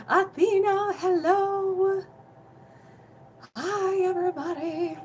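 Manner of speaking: bright, loud, high hello to a viewer; excited greeting. Real, not acted. Healer greeting a viewer joining her livestream, excited. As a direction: call out the name with real delight.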